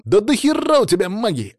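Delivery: high-pitched, irritated retort; snapping back. A narrator's reading. Sanya to Alexei about his magic, snapping. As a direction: snap back hard at a complaint. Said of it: "огрызнулся Саня".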